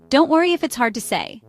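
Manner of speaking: usual speaking voice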